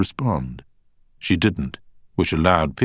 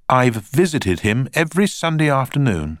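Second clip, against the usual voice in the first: a touch of pride, higher; proud; 10 semitones higher, 5 dB louder than usual. A narrator's reading. Beth tells the barrister of her visits, mildly proud. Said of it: "said Beth proudly".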